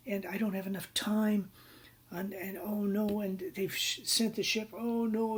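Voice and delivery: high-pitched mock worry; retold dismay, pitched well up; panicking. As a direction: imitate a client fretting as trouble piles up. Client panics over time and money.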